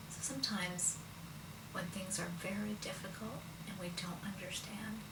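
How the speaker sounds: soft breathy whisper of faith. Real, not acted. Teller closing the story for listeners, a whisper. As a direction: hush it like a secret comfort.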